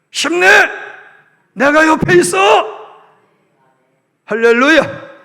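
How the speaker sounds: loud, high-pitched cry of courage; rallying shout. Acted. Sailor shouting encouragement to sinking comrades. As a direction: shout to drive out the fear. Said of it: "이렇게 외치는".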